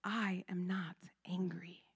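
soft, calm, steady reply. Real, not acted. Stopping the hysterical relative on the phone: soft and very calm. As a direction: calmly stop her in her tracks. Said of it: "I stopped her very calmly".

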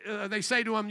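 ordinary delivery

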